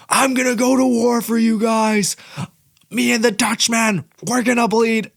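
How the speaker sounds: high, loud put-on fighter voice; fighter impression. Mimicking a fighter vowing war for fans, high and loud. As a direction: ham up a fighter's trash talk. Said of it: "I can't even do his American voice".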